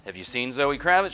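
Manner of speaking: normal talking voice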